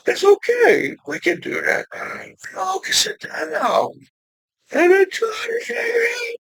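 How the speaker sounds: very high, soft, half-whispered squeak; high hushed reassurance. Acted. Speaking to everyone in the world, a high soft hushed coo. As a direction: coo it like soothing a frightened crowd.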